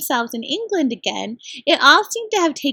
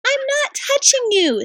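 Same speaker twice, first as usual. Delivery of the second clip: high protest; 12 semitones higher than usual. Acted. Susan denies, frightened.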